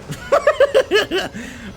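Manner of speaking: sudden high burst of laughter; loud laugh. Real, not acted. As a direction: cackle out loud over the music. Laughs loudly over game music.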